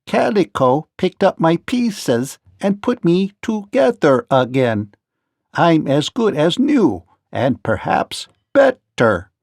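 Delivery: plain-spoken machine, a little proud; Tik-Tok explains to the king he is mended; Tik-Tok, clockwork man. Acted. Tik-Tok explains his repair.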